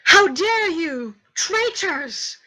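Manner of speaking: high, slow, indignant shout; outraged yell. Acted. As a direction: yell it as an outraged guard, drawn out. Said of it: "and he yells".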